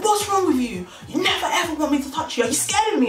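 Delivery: high-pitched, acted-out angry questioning. Older man confronting a reluctant girl, angry complaint. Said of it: "Why are you so angry".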